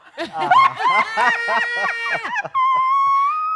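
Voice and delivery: screaming high laugh; loud burst of laughter. Real, not acted. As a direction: explode into laughter. Explosive laughter at joke.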